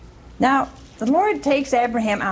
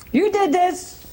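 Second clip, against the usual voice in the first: accusing wife; 8 semitones higher than usual. Retold or imitated. Sarah blames Abraham.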